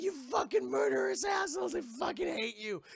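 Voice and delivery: high-pitched mock shriek of rage; hammed-up furious heroine; angry scream. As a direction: yell it high, in exaggerated outrage. Elizabeth acted screaming at pirates.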